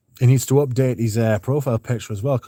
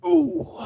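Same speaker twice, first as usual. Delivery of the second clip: high squeal. Real, not acted. Sudden reaction.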